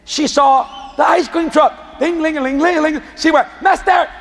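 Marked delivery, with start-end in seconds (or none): 2.0-3.0 higher sing-song bell imitation; ice cream truck jingle. Girl spots the ice cream truck, imitated jingle. 3.6-4.1 high, insistent repeated call; child calling out. Retold or imitated. Little girl hailing the ice cream man, insistent imitated call.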